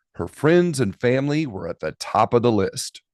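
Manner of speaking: usual speaking voice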